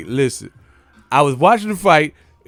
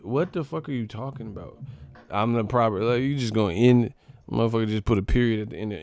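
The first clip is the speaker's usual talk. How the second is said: ordinary talk, nothing marked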